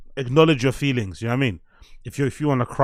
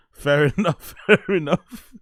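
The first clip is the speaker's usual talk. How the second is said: chuckled through, pitch climbing; amused, breaking into real laughter; laughing; 3 semitones higher, 3 dB louder, slower than usual. Real, not acted. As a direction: concede it while cracking up. Amused by chat's reply.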